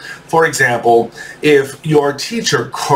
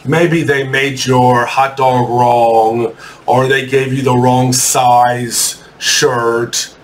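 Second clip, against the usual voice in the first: normal talking voice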